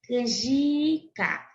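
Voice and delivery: canjica drawn out with JI held; very slow canjica, leaning on JI; stressed penultimate syllable. Shown as a demonstration. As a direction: stretch the middle of canjica, can-JIII-ca. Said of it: "possuem a penúltima sílaba como sílaba mais forte".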